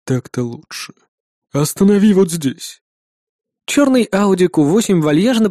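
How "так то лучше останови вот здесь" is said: weary boss. A narrator's reading. Clan head instructing his driver, weary. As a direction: settle back and instruct unhurriedly.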